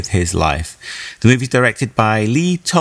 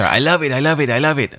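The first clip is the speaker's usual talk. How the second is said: raving in a light mock Spanish accent; attempted Spanish accent; 3 semitones higher than usual. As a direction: imitate a gushing friend with a rough Spanish accent. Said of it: "Joe's attempt at a Spanish accent".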